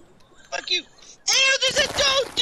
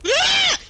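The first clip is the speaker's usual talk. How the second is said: much higher startled scream; high fearful scream; 11 semitones higher, 4 dB louder than usual. Real, not acted. Player surprised by a cannibal, high startled scream. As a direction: shriek at a sudden scare. Jump scare in game.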